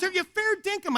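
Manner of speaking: high-pitched urgent question; retold pleading Australian question; exasperated insistence. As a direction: demand an answer, voice pitched right up. Man asks yet more insistently.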